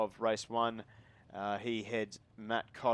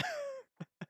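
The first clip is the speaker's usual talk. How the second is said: laugh; 9 dB quieter than usual. Real, not acted. Laughing at his own accent.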